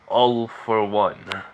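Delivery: drawn out slowly, each word weighted; slow dramatic reveal. Acted. Revealing his partner's name to Izuku, said slow and ominous.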